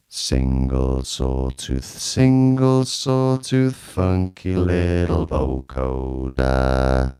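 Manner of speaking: slow robotic chant on held notes; vocoder voice. Shown as a demonstration. Reviewer showing a sawtooth vocoder, slow robotic chant. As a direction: intone syllables as a synth robot. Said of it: "to vocoding".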